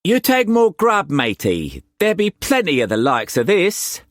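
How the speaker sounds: high, loud Cockney pirate-trader voice; Cockney swagger, pitched well up and pushed; harsh Cockney accent. A narrator's reading. Wu Kwok pressing food on Struan, in harsh Cockney accent. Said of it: "the harsh Cockney accent".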